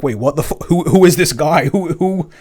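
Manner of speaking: loud, baffled audience voice; confused audience. Retold or imitated. Fan retelling audience reaction, loud baffled voice. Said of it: "And the audience go like".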